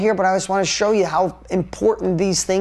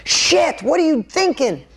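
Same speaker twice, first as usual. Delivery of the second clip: imitating a sharp, much higher exasperated yell at his son; retold angry swearing; 7 semitones higher than usual. Father recalling swearing at his son Brody, mock angry yell. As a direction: replay the frustrated dad snapping. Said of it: "And I've swore".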